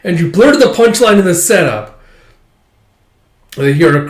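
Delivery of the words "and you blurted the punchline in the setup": higher, louder grossed-out retort; disgusted scold. Retold or imitated. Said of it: "It's like".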